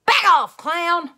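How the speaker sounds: very loud, high yell; shouted. Acted. Junie B. yells at the clown to get back, very loud. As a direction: bark it at the clown. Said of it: "I shouted".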